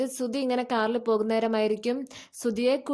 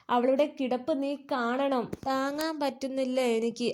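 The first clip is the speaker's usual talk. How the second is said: plain reading voice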